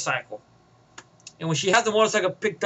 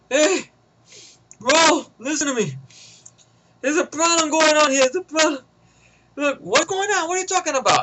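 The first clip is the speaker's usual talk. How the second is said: radio henchman; 7 semitones higher than usual. Retold or imitated. Henchman on the radio calling Pace, worried.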